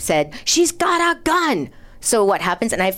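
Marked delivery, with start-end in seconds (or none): 0.4-1.7 high, slow mock scream; imitated scream. Resident retelling the gun scare, imitating a scream. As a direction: stretch it out high like a screaming neighbour. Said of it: "I don't know which one screamed it".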